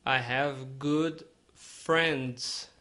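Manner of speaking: very slow, careful English model line; slow model sentence. Shown as a demonstration. Teacher modelling slowly for Brazilian learners. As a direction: stretch each word for learners to copy. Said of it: "quase não fala o i".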